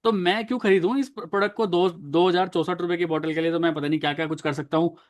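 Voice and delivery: ordinary talk, nothing marked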